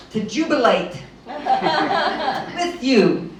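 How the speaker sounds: laughing. Real, not acted. Laughs while saying jubilate.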